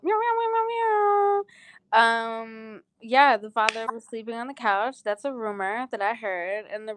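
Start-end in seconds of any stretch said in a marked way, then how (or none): 0.0-2.0 high, drawn-out string of meows; playful meowing. Pretend. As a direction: meow it out high like a silly siren. Playful imitated noise after DJ moment.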